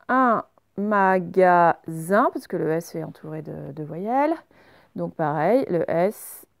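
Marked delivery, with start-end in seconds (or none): 0.1-2.3 slow, drawn-out dictation; dictating syllable by syllable. Shown as a demonstration. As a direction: stretch the word for pupils to write. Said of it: "dictating word to class".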